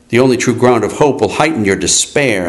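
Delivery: ordinary delivery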